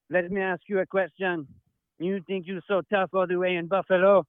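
put-on French accent, sneering at Buffalo; mock French accent. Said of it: "I'll make fun of the French".

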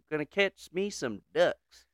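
ordinary talk

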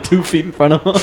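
ordinary delivery

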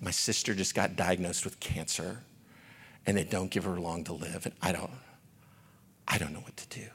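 hushed, low, sorrowful imitation; understated weeping retold. Coworker telling him of her sister's cancer, quietly distraught. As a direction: say it softly, as if worn down by grief. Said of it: "she starts weeping".

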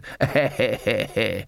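card sharper's rhythmic snicker; put-on chuckle. A narrator's reading. Third man chuckles at Granny's luck, slyly. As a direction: laugh along in character.

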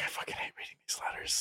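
whispered bitter gripe; grumbling whisper. Pretend. Moth tender griping over letters in a whisper. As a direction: hiss it under your breath, fed up. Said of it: "who's like".

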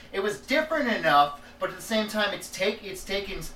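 normal talking voice

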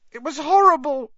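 loud, very high-pitched upset voice. A narrator's reading. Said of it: "in a higher voice than usual".

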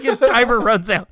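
ordinary talk, nothing marked

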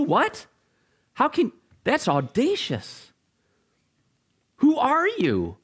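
high-pitched, acted-out astonishment; scandalised disbelief. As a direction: challenge him as if appalled by the audacity. Acting out a scandalised listener.

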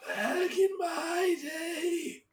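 put-on high, slow, cracking old man voice; quavering ancient grandpa, drawn out slow. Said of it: "that was so good".

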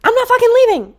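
high, loud defiant yell; Leonardo DiCaprio. Retold or imitated. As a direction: shout it like a defiant movie hero. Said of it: "He goes".

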